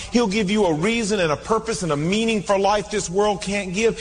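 ordinary talk, nothing marked

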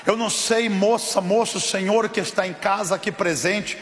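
loud, emphatic sermon climax; full-force preaching. Real, not acted. Preacher calling the congregation to stand, at full force. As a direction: proclaim it to the whole church, punching each phrase.

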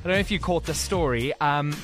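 normal talking voice

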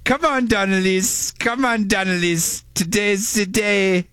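put-on high, very slow German accent. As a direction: boom it out in a thick German accent. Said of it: "Can you read a text in German".

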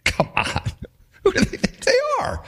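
mocking laughter; scoffing laugh. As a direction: laugh off the whole project. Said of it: "this project's a joke".

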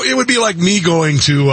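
usual speaking voice

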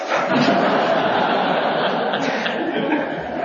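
high, unvoiced laugh; real high laugh breaking out. Real, not acted. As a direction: burst out in a high airy laugh. Laughs at the punchline.

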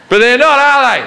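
put-on high Australian accent, teasing; a high Aussie 'are they?'; Australian guard.

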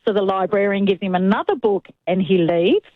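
normal talking voice

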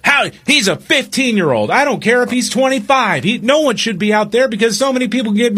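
high mock ranting mother; imitating irate mom. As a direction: rant at the manager as mom. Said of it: "And just go".

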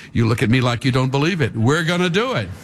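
usual speaking voice

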